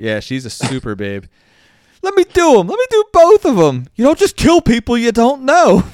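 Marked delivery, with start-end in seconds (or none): 2.0-5.8 much higher and louder eager movie quote; hammed-up henchwoman. Host acts out the henchwoman's line, high, loud and gleeful. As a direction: belt it out like a gleeful hired killer.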